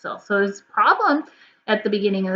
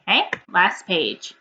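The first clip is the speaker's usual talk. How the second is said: ordinary talk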